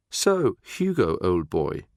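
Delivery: slow, mock-posh drawl; sarky, drawn-out posh accent; affected posh accent. A narrator's reading. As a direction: put on a mocking upper-class accent. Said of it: "affecting a posh accent".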